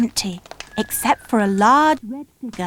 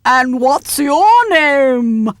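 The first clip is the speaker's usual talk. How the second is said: slow, louder booming Father Christmas; 3 semitones higher, 5 dB louder, much slower than usual. A narrator's reading. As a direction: boom it out like a big jolly Santa. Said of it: "boomed the voice of Father Christmas".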